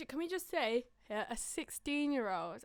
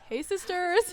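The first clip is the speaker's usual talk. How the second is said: loud, higher-pitched influencer greeting; James Charles; 6 semitones higher, 12 dB louder, slower than usual. Acted. Co-host mimics a YouTuber's greeting, loud impersonation. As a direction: belt out a mock YouTuber intro. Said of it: "James Charles here".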